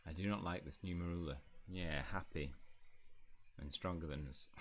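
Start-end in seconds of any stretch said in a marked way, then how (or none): none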